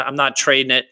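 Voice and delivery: usual speaking voice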